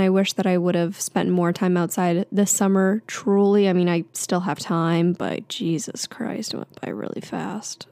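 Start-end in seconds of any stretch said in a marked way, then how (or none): 5.1-7.9 breathy whisper, slow and wistful; whispered aside. Real, not acted. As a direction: let it fade to a whisper. Wistful aside about summer passing.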